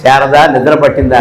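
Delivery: low, gentle asking of his wife; low, tender husband greeting his wife; tender morning question. Retold or imitated. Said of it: "నేను లేవగానే".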